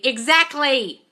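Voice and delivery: ordinary talk, nothing marked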